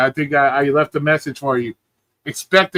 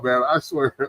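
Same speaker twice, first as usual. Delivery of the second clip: usual speaking voice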